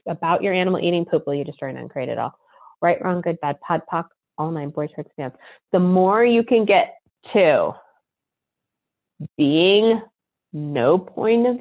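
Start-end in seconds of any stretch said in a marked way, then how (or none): 1.3-5.3 fast, soft, murmured recitation; rapid clearing statement. Acted. Host running the clearing for listeners, a fast, soft, murmured rote recitation. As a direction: rattle the formula off under your breath.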